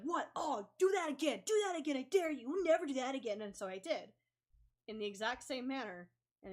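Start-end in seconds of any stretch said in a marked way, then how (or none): 0.0-3.4 loud, high mocking taunt; taunting dare. Kid taunting the narrator after the kick, loud mocking dare. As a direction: goad her into kicking again. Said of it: "she turned around and was like".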